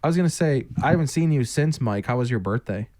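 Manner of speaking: normal talking voice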